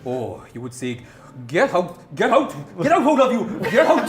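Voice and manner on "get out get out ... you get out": put-on shouted orders in a Welsh accent. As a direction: yell it, Welsh accent, laughing. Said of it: "How do you say it in a Welsh accent".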